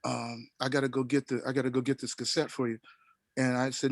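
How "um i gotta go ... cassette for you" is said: soft, lowered impression of a host; understated Miles. Retelling a host offering his nervous guest a drink, softly imitated. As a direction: lean in and speak softly, imitating him. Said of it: "Miles leaned over to me and said".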